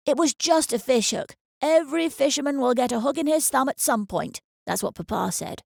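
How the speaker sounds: a nine-year-old playing the man; a touch grown-up. A narrator's reading. Boy shrugs off the wound to mother, mock grown-up. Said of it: "sounding beyond his nine years".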